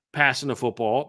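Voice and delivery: ordinary talk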